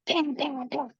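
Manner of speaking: high, ringing bang sound effect; high mock metallic bangs; imitated metal banging. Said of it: "you could hear the metal go".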